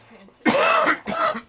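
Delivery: high, loud, very drawn-out; exaggerated awe. Real, not acted. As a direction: stretch it out in mock amazement. Mocking the fancy beds.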